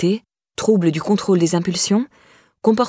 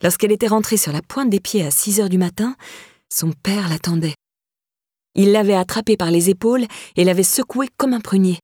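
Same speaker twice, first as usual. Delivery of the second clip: usual speaking voice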